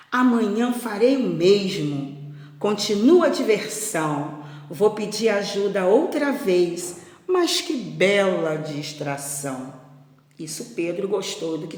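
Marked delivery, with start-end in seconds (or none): 0.1-9.7 put-on mildly pleased storybook boy; a touch of smugness, read aloud; self-satisfied. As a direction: relish your plan for tomorrow. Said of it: "Muito satisfeito".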